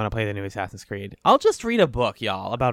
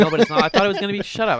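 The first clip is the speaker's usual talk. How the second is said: laughing; 9 semitones higher, 6 dB louder, faster than usual. Real, not acted. Laughing at cat reveal.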